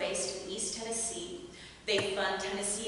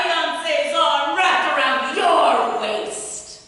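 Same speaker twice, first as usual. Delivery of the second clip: loud slow higher shouting; furious shout; 6 semitones higher, 13 dB louder, slower than usual. Acted. Jilted bride screaming at her rival, furious. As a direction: hurl it at her across the years.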